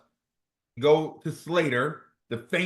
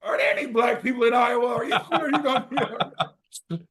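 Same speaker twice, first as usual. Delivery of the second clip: higher and faster, anxious parents; hammed-up worried parent, higher; 10 semitones higher than usual. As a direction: play the fretting mom and dad. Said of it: "My mom and dad was like".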